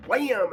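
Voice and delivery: high, sharp mock impact cry; imitated wham. Mimicking the board breaking, a high sharp wham. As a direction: blurt the crash of the board. Trainee smashing through the board.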